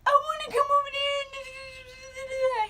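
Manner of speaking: loud, high, drawn-out mock wail; hammed-up crying plea; imitated bawling. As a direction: sob it out, stretching the words. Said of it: "I'm just crying".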